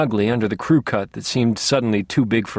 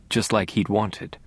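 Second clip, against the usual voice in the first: plain reading voice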